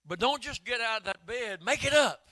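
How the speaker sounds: loud, high ringing preacher's cry; preaching at full force. Acted. Preacher crying out the healing of Aeneas, loud and high. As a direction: shout the healing word to the church.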